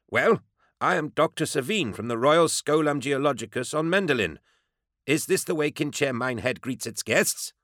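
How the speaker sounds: high, haughty querulous voice; querulous, piqued authority. A narrator's reading. Visiting doctor rebukes the town's welcome, querulous and piqued. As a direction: complain like an affronted dignitary. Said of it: "querulous tone of piqued authority".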